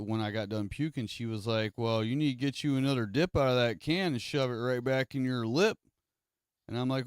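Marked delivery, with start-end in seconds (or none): 1.8-5.8 a raised, bossy mom voice, a bit louder; stern mom. Retold or imitated. Mom scolding her sick son, raised and bossy. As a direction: order him to take another dip.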